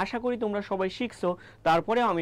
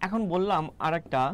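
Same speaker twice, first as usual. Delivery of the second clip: normal talking voice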